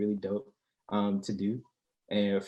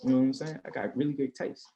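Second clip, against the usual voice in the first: ordinary delivery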